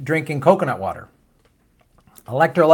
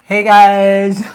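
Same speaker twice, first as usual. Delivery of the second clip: loud greeting; 5 semitones higher, 8 dB louder than usual. Real, not acted. Calling out to viewers.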